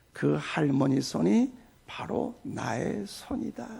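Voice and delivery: soft, slow, low divine voice; Lord's voice. Retold or imitated. The Lord speaks to the pastor, soft slow low voice. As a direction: speak gently and slowly as the Lord. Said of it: "주님의 음성이 들립니다".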